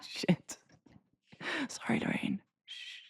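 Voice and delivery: hushed whisper after a rude word. Real, not acted. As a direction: drop to a guilty whisper. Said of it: "I thought you were whispering".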